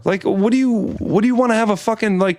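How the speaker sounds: high, worked-up complaint; ranting to friends about the clerk, worked-up; indignant rant. Retold or imitated. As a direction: rant in disbelief.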